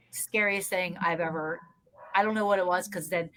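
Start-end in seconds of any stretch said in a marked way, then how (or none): none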